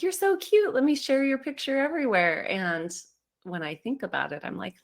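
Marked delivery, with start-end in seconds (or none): 0.0-2.5 high gushing voice over a cute picture. Retold or imitated. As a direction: squeal it like doting on a baby photo. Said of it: "but I'm like".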